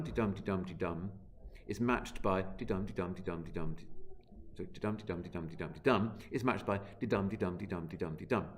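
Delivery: chanted de-dum beats, evenly stressed; de-dum rhythm. Shown as a demonstration. Teacher to class, chanting the de-dum beat. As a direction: tap out the matched meter with de-dum. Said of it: "stichomythia actually means de-dum".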